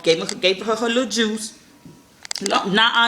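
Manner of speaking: usual speaking voice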